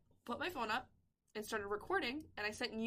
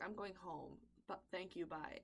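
tired, trailing farewell; mock exhausted exit, softer; drained goodbye; 5 semitones lower, 11 dB quieter than usual. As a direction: lower and much softer. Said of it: "she literally walked out and was like".

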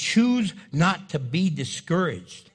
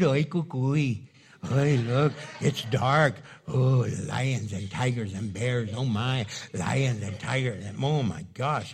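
lower, hushed mock-scared voice; mock fright; 9 semitones lower, slower than usual. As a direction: play a spooked kid in the dark. Mock fear in dark valley.

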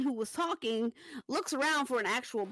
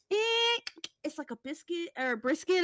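high eh then mumbled unsure answer; unsure cook; 6 semitones higher than usual. Retold or imitated. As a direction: play the squinting, hesitant cook. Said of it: "He's like".

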